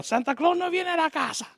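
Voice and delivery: high-pitched Spanish scolding voice; Latin grandparent. Retold or imitated. Imitating grandparents threatening a crying child, high Spanish warning. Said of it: "my grandparents are very Latin".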